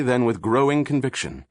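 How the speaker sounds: usual speaking voice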